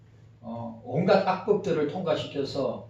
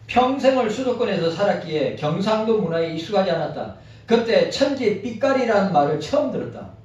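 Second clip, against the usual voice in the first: reading aloud; 3 semitones higher, 3 dB louder than usual. Acted. Reads a written article aloud.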